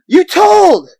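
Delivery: betrayed yell; shrill accusing shout. Acted. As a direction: shriek it, high and loud. Accusing outburst in the scene.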